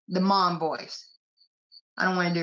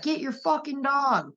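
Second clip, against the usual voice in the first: higher, sharp mock yell from dad; dad's angry voice; 6 semitones higher than usual. Dad yelling at his kid about the dog, mock angry shout. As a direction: bark it like an annoyed dad. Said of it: "He was like".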